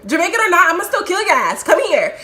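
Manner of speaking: high, loud, furious retelling; worked-up re-enacted yelling; screamed threat. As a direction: threaten him while giving chase. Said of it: "screaming at the top of my lungs, threatening".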